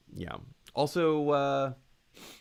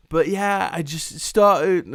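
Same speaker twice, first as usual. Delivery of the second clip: louder, higher attempt at an English accent; mimicked English accent; 5 semitones higher, 7 dB louder, slower than usual. Shown as a demonstration. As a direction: try out a slightly polished English accent. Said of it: "I would mimic his".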